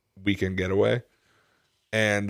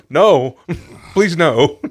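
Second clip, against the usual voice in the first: loud, higher mock plea; mock pleading; 5 semitones higher, 7 dB louder, much slower than usual. Comic imagining a boxer threatening him, mock pleading. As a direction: beg him off, half laughing.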